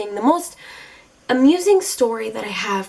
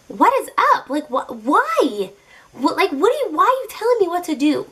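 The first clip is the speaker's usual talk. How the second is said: higher pitched, outraged questions; indignant disbelief; 6 semitones higher than usual. Retold or imitated. Told off at the gelato counter, pitched-up indignant disbelief. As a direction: act stunned that he would boss you. Date forbids her ice cream.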